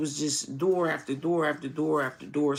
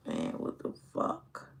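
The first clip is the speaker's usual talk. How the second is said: low, whispered defeated curse; discouraged mutter; 11 semitones lower, 10 dB quieter than usual. Retold or imitated. Retelling his discouraged moments, whispered muttered despair. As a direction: let it slip out under the breath. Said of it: "I'm discouraged and I'm like".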